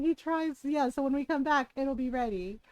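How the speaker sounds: usual speaking voice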